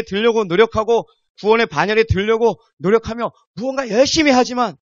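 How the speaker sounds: high-pitched, driving preacher's list; building emphatic preaching. Acted. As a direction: pile up each striving phrase with rising force. (점점 고조되는 열띤 설교)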